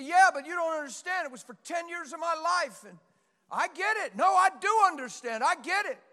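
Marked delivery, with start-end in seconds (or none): 0.0-2.8 mock excuse. Congregant's excuse voiced by pastor.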